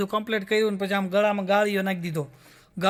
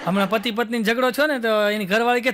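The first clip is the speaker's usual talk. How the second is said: ordinary talk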